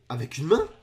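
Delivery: baffled question. Real, not acted. Reacting to one-handed solve, baffled question. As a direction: ask it as if unsure you saw right. First, quieter disbelief before yelling.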